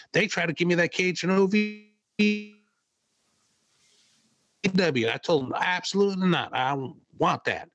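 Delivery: high-pitched mock Cornette voice; imitating Cornette. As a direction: retell his rant in his voice. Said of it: "he's like".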